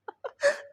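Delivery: a quick high burst of real laughter; short laugh. Real, not acted. Teacher recalling being fooled with sweets, laughing. Laughing at his childhood story.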